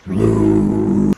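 very low, long drawn-out villain call; playful deep taunting call; menacing call. Pretend. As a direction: stretch the name into a deep, loud groan. Calls menacingly after Skywalker.

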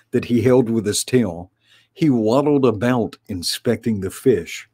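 usual speaking voice

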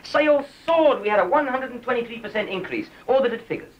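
high-pitched, excited boasting; eager, worked-up pitch to the boss; enthusiastic self-defence. Acted. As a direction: plead your success with rising excitement. Employee defends his campaign to boss.

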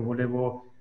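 usual speaking voice

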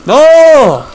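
loud, high cry of no; anguished shout. Real, not acted. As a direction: scream it as you lose. Dies in the round.

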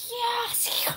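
high, loud breathy burst; fierce yell. Retold or imitated. Jesus leaping in the second she needs him, fierce yell. Acts out Jesus springing to her defence.

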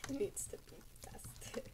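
soft whispered mimicry; hushed, voiceless aside; whispered echo. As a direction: echo it back under your breath. Echoing the other's words.

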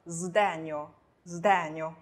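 sdegno starting with buzzed zd; voiced s. Shown as a demonstration. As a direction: model sdegno slowly, initial s as z. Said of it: "这个时候s发浊音".

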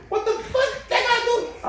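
very high-pitched, incredulous squawk; hammed-up high indignation; indignant comeback. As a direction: protest in a squeaky, disbelieving voice. Acting out indignant comeback in quarrel.

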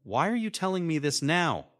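high-pitched, strained question; mildly choked, high plea. A narrator's reading. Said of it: "I managed to choke out".

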